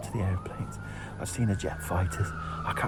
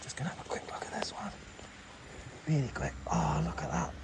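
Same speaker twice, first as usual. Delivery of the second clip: whispering; 6 semitones higher, 5 dB quieter than usual. Real, not acted. Sneaking past the guard tower.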